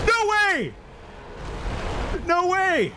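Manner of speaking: very high, loud disbelieving scream; excited screaming. Real, not acted. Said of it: "I'm sorry for yelling so loudly".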